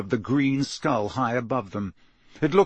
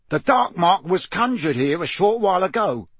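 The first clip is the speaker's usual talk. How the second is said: stern, raised interrogator's voice; pointed, higher-pitched accusation; Mister Diggory; 6 semitones higher, 3 dB louder than usual. A narrator's reading. Mister Diggory confronts the elf over the dark mark, stern accusation.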